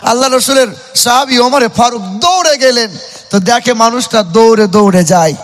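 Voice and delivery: normal talking voice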